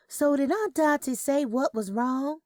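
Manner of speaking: a hint of a grown daughter asking on the phone; daughter's voice.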